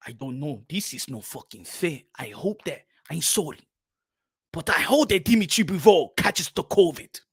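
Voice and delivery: put-on aggrieved boxer grumbling in African accent; Makabu. Makabu sulking about Bivol.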